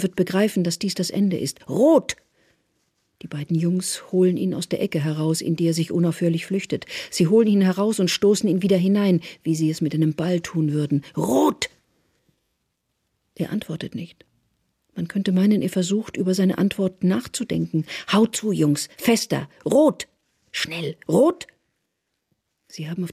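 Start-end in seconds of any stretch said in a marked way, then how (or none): 1.7-2.2 high, loud rising cry; sharp demand. A narrator's reading. Therese pressing the beaten informer, sharply demanding. 11.2-11.7 high, loud rising cry; sharp demand. A narrator's reading. Therese pressing the beaten informer, sharply demanding. 18.1-21.5 terse orders with leaping, rising cries; urgent commands. A narrator's reading. Therese egging on the boys, urgently commanding. Therese urges beaters and questions.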